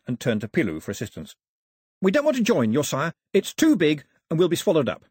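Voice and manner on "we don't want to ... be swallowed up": high, firm, louder refusal; raised, insistent young voice; protesting islander. Acted. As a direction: refuse the king emphatically.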